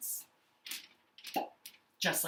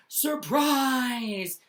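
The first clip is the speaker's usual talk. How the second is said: high, loud surprise shout; excited yell; 7 semitones higher, 7 dB louder than usual. Shown as a demonstration. Teacher demonstrates exclamation marks, excited yelling. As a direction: shout it big, as if springing a surprise. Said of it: "like, yelling, more for really exciting".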